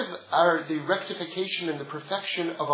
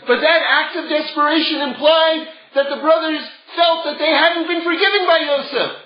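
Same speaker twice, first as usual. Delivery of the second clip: usual speaking voice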